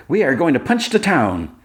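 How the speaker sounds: high, earnest announcement of "punch the town"; Kara announcing plans to Clark, high and proud. Retold or imitated. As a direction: declare it boldly, missing the idiom. Kara misuses an idiom.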